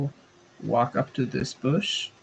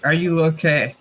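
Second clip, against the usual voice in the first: louder call out; 4 semitones higher, 6 dB louder than usual. Acted. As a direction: repeat it higher and louder. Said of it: "I'm gonna repeat".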